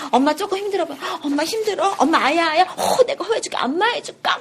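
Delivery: put-on child sweetly offering to soothe mom, high. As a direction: coo like a little child pleasing mom. Child cooing to mother.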